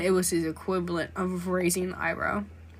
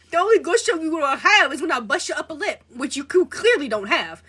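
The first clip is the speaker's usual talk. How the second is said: sassy clapback; 8 semitones higher, 6 dB louder than usual. Pretend. Sassy comeback to the killer.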